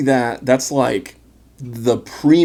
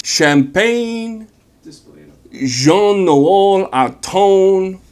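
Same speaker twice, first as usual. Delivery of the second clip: slow, much higher hammed-up French accent; mock French accent; 6 semitones higher, much slower than usual. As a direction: savour the name in an overdone French accent. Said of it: "my wife makes fun of me of my French accent".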